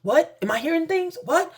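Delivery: high-pitched, incredulous questions, imitated; startled disbelief. Mother hears her daughter's voice, retold in startled disbelief. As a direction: play a stunned mother, voice jumping up.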